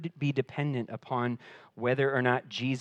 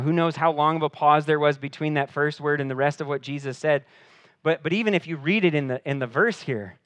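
usual speaking voice

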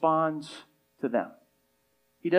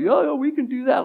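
arrogant disciples; 8 semitones higher than usual. Retold or imitated. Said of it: "sense the arrogance in them".

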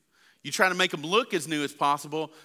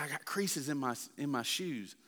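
softer, glum complaint; sulky son; 9 dB quieter than usual. Retold or imitated. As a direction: mumble it like a sulking teen. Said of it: "He said".

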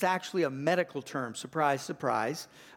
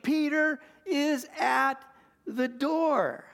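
much higher and louder, slow, overjoyed; excited exclaim; 9 semitones higher, 4 dB louder, much slower than usual. A narrator's reading. Servant girl Rhoda telling the house Peter is there, overjoyed cry. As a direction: burst out with joy at the news. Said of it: "And she exclaimed".

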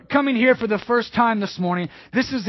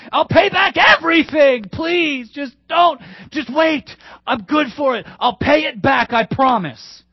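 high, loud frantic pleading; desperate begging; 4 semitones higher, 3 dB louder than usual. Acted. Servant begs the king not to sell his family, frantic pleading. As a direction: plead for your life, voice straining.

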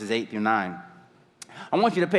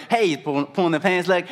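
ordinary delivery

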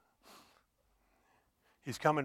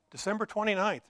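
normal talking voice

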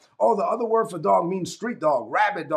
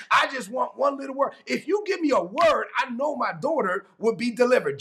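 rising, desperate plea, higher and building to shouts; pleading; 5 semitones higher than usual. Acted. Canaanite woman begging Jesus for one touch, urgent plea. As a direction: beg with everything you have.

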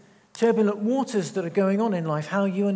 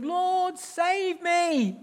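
much higher, desperate plea; Peter's cry for help; 10 semitones higher, much slower than usual. Acted. Sinking Peter calling to Jesus, a desperate plea.

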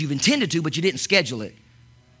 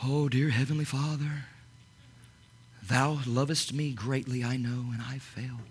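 lower, softer, slow mock-pious prayer; mock solemn King James prayer; fake prayer voice; 7 semitones lower, 10 dB quieter than usual. Shown as a demonstration. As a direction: put on the churchy prayer voice. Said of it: "that facade voice".

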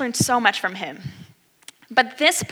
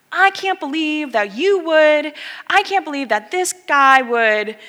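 mock outraged student; 6 semitones higher, 6 dB louder than usual. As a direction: play the mouthy kid losing it. Student mouthing off in class.

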